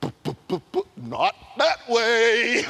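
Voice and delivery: donkey refusing to pass the angel, lightly voiced. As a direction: play the donkey balking, slowing down. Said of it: "Donkey sees".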